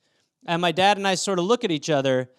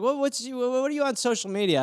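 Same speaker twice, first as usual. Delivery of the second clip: put-on high-pitched, curious girl's voice; high mock-female question; imitated girl; 6 semitones higher than usual. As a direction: mimic her asking, pitched well up.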